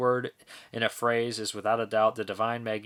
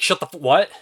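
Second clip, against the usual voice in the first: loud, higher cutoff into a puzzled question; imitated interrupted outburst; 5 semitones higher, 9 dB louder than usual. Imitating a Big Lebowski character, loud interrupted outburst. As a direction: start to snap loudly, then break off to ask. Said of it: "he's like".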